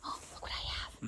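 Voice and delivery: hushed unvoiced offer; whisper. Real, not acted. Offering a treat to the sheep in a whisper.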